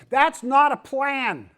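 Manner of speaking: loud, high, slow exclamation; emphatic rebuttal. Real, not acted. Preacher rebutting a pastor's plan for the church, loudly emphatic. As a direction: belt out each word in protest.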